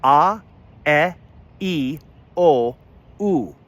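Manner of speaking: pure short vowels a, e, i, o, u, one by one; Esperanto vowels. Shown as a demonstration. Teacher modelling the five vowels for learners. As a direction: say each vowel clean and separate. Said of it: "And you pronounce them".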